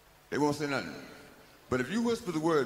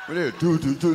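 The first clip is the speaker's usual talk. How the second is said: Jaws theme hum; 9 dB louder than usual. Retold or imitated. The Jaws theme warns the audience, loud ominous hum. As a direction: hum the shark music menacingly.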